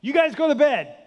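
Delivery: calling up. Retold or imitated. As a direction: order the kids to bed from below. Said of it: "just says".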